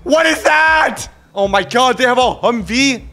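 high, loud, drawn-out disbelief; shocked shout. Real, not acted. Driver spotting a police Humvee, shocked shout. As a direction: yell in alarm at what's coming. Spots a Humvee chasing him.

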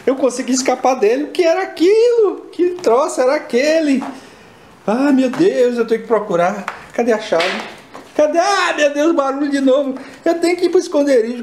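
high, loud frantic voice. Acted. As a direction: search in a frantic rush. Escaped, searching frantic.

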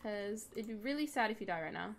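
ordinary talk

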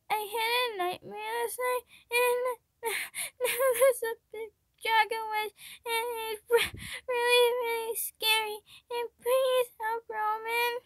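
put-on high, tearful little boy, words wet with crying; a scared ten-year-old sobbing out his nightmare; frightened kid pleading, high and teary; sniffling child. A narrator's reading. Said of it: "the ten-year-old sniffed".

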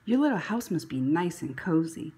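ordinary delivery

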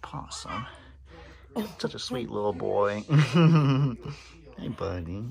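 hushed whisper to a drowsy pet. Real, not acted. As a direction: breathe the name so the animal stays asleep. Hushed near sleeping animals.

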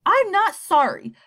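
a son shouting that he isn't sorry; defiant yell. Acted. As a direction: throw it in his face. Son yelling defiance at father.

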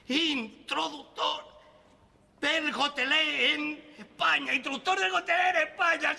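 proclama lenta y alta, con orgullo; anúnciate como un pregonero; anuncio solemne. Acted. (proclamación solemne y lenta)

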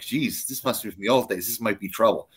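a quiet nervous aside to yourself; inner worry. Retold or imitated. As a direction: say it under your breath like you're in trouble. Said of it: "I'm like".

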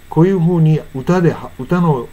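normal talking voice

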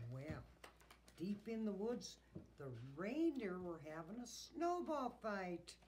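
usual speaking voice

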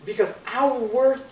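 loud, slow, building preaching. Acted. Preacher assuring the congregation of their worth, loud and building. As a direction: drive the point home.

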